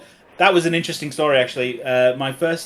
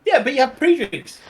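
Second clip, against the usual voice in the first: insistent pre-drinks; 11 semitones higher, 4 dB louder than usual. Real, not acted. Arguing about the word pre.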